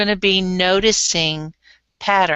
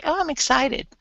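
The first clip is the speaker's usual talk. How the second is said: higher excited; 6 semitones higher than usual. Shown as a demonstration.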